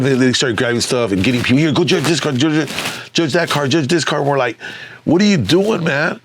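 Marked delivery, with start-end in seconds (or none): 1.6-4.2 high, repetitive mock instructions; retold frantic orders. Organizers sending grabbed stand-ins to judge cars, frantic. 5.0-6.2 high-pitched acted objection; retold exasperated protest. Crew objecting to untrained judges, exasperated.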